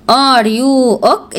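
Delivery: Are you ok asked very slowly; slow model question. Shown as a demonstration. Teacher models the question slowly for Mohamed. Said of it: "نقول إيه يا محمد؟".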